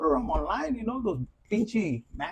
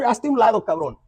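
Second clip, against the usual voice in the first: mock tough-guy shove; 3 semitones higher, 7 dB louder than usual. Acted confrontation with rival man.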